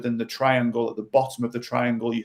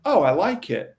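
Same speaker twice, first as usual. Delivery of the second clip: relaxed reply; 6 semitones higher than usual. Retold or imitated. Chris's imagined relaxed reply.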